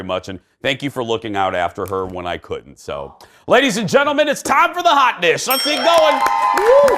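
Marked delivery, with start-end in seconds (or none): 3.5-6.2 higher, louder hype announcer voice; radio host launching the hot dish segment, hyped up; show host intro. Acted. 6.6-7.0 high, loud excited whoop; host cheering to open the segment, high and loud. Acted.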